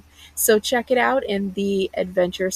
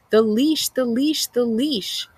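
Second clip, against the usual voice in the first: mocking taunt; 4 semitones higher, slower than usual. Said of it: "I say".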